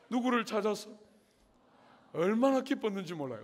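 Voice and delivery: ordinary talk, nothing marked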